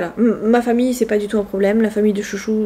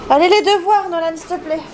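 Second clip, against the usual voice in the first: higher calling voice; 10 semitones higher than usual. Real, not acted. Calling son to homework. (appel à son fils)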